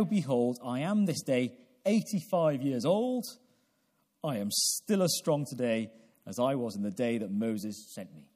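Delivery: ordinary delivery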